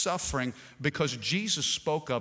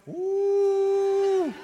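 mocking high ooh; 5 dB louder than usual. Mocking selfie culture.

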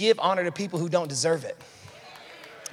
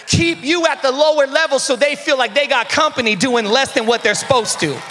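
louder, higher preaching voice, building; full-force preaching; 5 semitones higher, 4 dB louder than usual. Acted. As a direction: preach it hard to the room.

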